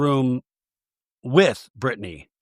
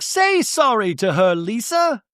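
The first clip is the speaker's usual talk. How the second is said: high-pitched imitated order to apologize; exaggerated high, insistent prodding; mock scolding; 8 semitones higher than usual. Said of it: "Bronwyn is like".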